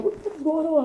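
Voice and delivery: high-pitched, incredulous question; retold astonishment. Manager asking the crying designer what happened, astonished. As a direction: imitate a baffled manager, voice way up. Manager reacting to his shock.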